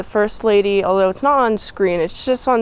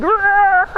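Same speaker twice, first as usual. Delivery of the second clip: growl; 10 semitones higher, 3 dB louder than usual. Retold or imitated. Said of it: "the ninjas just go hard. They're going".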